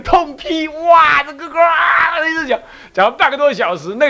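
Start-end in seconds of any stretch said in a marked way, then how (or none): 0.0-2.6 half-hearted retold shouting; mock tirade. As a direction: relive the scolding with a cry. Recalling fierce scolding.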